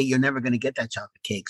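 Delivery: ordinary talk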